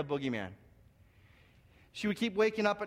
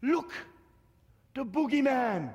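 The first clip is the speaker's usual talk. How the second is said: high-pitched cry of alarm; frightened little sister; 6 semitones higher, 4 dB louder than usual. Retold or imitated. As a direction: imitate a scared little girl wailing. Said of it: "my sister begins to cry".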